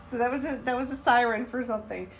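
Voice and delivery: ordinary talk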